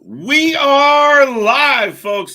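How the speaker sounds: drawn-out, high, loud stream opener; hype announcer. Acted. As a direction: stretch it out big like a showman. Opening the stream, hyping.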